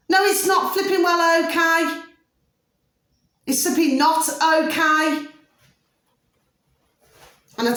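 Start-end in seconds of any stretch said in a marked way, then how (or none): 0.1-5.3 angry shout. Real, not acted. Vlogger snapping back at people who just say okay, angry shout. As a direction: blow up, then hammer the second line slower.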